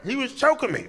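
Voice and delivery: high, whining protest; whiny roommate. Retold or imitated. Drunk roommate complains to cop in high whine.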